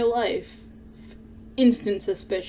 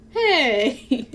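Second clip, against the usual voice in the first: ordinary talk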